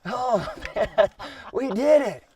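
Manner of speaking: high, loud, laughing with joy; excited laughing. Real, not acted. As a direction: laugh and cheer the win. Celebrating the kill with his son.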